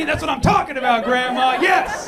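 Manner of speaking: high, loud cheer for grandma; thrilled shout. Acted. Thrilled grandson reacts to grandma's accent.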